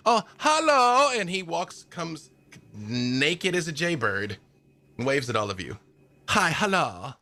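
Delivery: chatty eccentric woodsman, pleased with himself; lightly German-accented cheerful stranger; Albrecht the nudist. Acted. GM voices Albrecht NPC.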